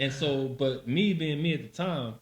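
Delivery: usual speaking voice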